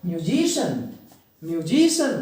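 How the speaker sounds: Musician with JI stressed and stretched; musician said myu-JI-shan; stress on middle syllable. Shown as a demonstration. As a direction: lean on the JI in musician. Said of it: "अपनी आवाज़ को stress देना है".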